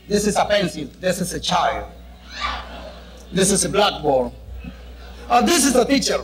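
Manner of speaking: a hint of a Venezuelan accent drilling classroom English; mock English lesson. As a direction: recite like a Spanish speaker's first English class.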